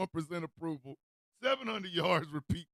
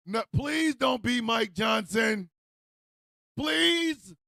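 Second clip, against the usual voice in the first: louder, higher, slow comic begging on please; emphatic pleading; 5 semitones higher, 4 dB louder, much slower than usual. Real, not acted. Begging Mike Johnson not to be the one, emphatic plea. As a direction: stretch out please, pushing it up.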